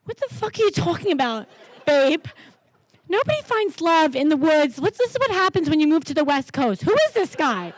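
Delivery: imitating her husband. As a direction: play the baffled overprotective husband, hammed up. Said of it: "And he says to me".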